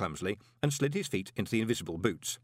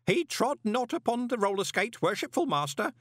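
high, ceremonious proclamation; old postman reporting up to hidden watchers; formal report; 3 dB louder than usual. A narrator's reading. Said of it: "Groat reported to the invisible watchers".

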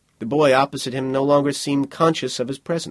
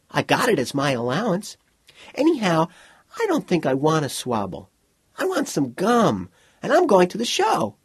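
put-on high, petulant child's voice; suspicious young boy; 7 semitones higher than usual. Boy weighing a swabble against gum and the show, suspicious and childish. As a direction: pitch it up like a kid losing interest.

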